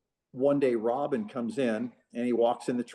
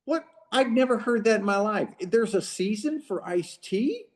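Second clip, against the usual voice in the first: high-pitched incredulous retelling; amused disbelief; 9 semitones higher than usual. Retold or imitated. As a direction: imitate a baffled friend, voice pitched way up. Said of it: "he starts laughing, and he goes".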